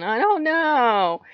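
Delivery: high, drawn-out, loud mock "I don't know"; mimicked clueless answer. Mimicking the other's endless answer to her question, mock exasperated. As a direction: stretch it out high in exasperated imitation. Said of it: "you've been going".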